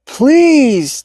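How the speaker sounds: high, loud desperate call; shout. A narrator's reading. Trapped man shouting at passersby, desperate. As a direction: call out as if no one hears. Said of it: "Tommy shouted".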